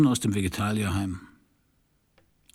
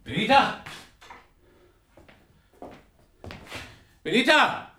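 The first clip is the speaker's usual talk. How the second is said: calling out; 12 semitones higher than usual. Acted. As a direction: shout the name, searching for her. Searching the empty flat, calling.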